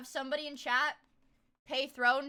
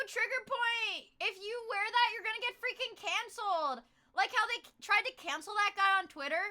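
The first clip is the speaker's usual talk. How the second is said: mock outrage; 7 semitones higher than usual. Mock outrage about canceling.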